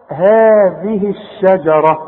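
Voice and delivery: هذه الشجرة slowly, haa held two counts; measured long vowel. Shown as a demonstration. Quran teacher models هذه الشجرة with measured madd. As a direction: stretch the first vowel of هذه, light sheen in الشجرة. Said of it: "عشان تمدها حركتين".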